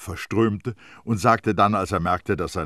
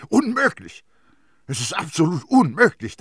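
high, loud outburst; emphatic, pitched-up protest; indignant denial; 12 semitones higher, 10 dB louder than usual. A narrator's reading. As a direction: exclaim it in shocked disbelief. Priest outraged at devil claim.

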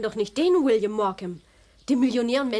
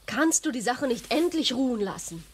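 ordinary delivery

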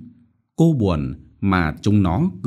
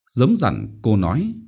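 plain reading voice

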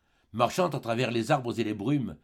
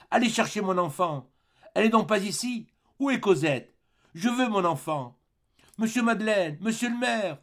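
high, desperate rising pleas; frantic pleading; 10 semitones higher, 4 dB louder than usual. Acted. Fantine begs the mayor to fetch Cosette, high and frantic. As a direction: beg in mounting panic for the child. Dying mother pleads for child.

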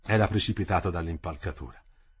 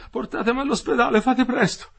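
very high, urgent, louder plea; agitated pleading; 14 semitones higher, 6 dB louder than usual. A narrator's reading. Injured worker begging for the hospital, agitated. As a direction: beg urgently in a strained high voice. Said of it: "si agitava".

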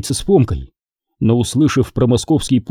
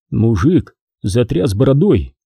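usual speaking voice

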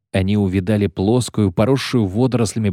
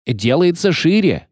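high, excited call; shouted report; 8 semitones higher than usual. A narrator's reading. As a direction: call it out from the bow. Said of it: "крикнул с носа Фиш". (крик с носа корабля)

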